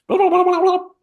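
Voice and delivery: high, squeaky mock grumble; mock complaining. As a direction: mimic a whiny little complainer. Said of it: "he started complaining at me".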